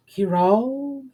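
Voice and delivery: high, puzzled echo of the name; puzzled mutter. Acted. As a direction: repeat it as if half remembering. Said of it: "the figure muttered".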